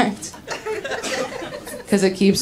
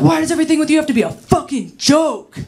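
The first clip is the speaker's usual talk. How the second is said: higher, rushed imitation of an angry lover; worked-up exasperation; 6 semitones higher, faster than usual. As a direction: act out a fed-up outburst. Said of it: "he was all worked up".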